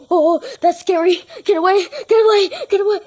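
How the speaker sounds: high, loud, fast get away; frantic, scared begging; scared pleading. Real, not acted. Scared, pushing creature away.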